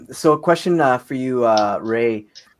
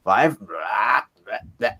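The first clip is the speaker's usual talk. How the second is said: imitating number reading; 5 semitones lower, 4 dB quieter than usual. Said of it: "when you're like".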